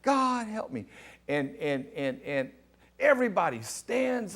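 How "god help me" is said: high mock cry for help; imitated scream. Imitating the stiff preacher screaming out on the floor, mock scream. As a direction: retell it as a raised, pleading yell. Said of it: "he's screaming out".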